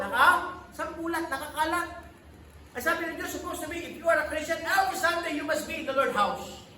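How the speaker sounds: loud, high-pitched sermon delivery, building with each line; full-force preaching. Acted. Pastor urging the congregation to church, in loud preaching. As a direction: drive it home like a pastor at the pulpit.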